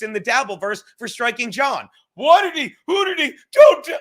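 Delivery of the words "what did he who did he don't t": much higher and louder, sputtering mock outrage. Mocking his outraged critics in a squeaky sputter.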